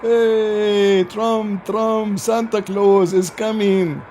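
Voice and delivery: high, drawn-out calling out; mock cheering. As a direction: hail him like an adoring crowd, mockingly. Mocking crowds cheering Trump's visit.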